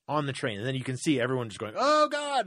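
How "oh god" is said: much higher, loud, appalled "Oh God"; disgusted gasp. Retold or imitated. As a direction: squeal it like a grossed-out commuter. Everyone reacting to her pissing herself.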